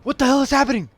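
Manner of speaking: high, loud, frantic questions; alarmed shouting while being shot at; panicked yelling. Real, not acted. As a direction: yell in real confusion as shots ring out. Panicking at gunfire in game.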